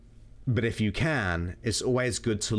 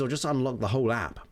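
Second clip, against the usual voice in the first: normal talking voice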